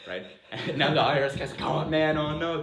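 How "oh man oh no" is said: mock panic, loud and higher; a hammed-up "oh no" of the cornered auditor; loud retold dread; loud dismay. IRS guy realizes he owes three grand.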